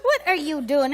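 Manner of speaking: normal talking voice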